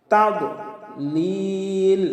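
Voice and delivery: তাদলিল with লিল drawn three counts; three-beat stretch. Shown as a demonstration. Teacher chanting তাদলিল with a three-count stretch. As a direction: hold লিল long. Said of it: "লিলে তিন আলিফ টান হবে".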